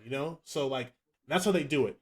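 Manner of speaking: usual speaking voice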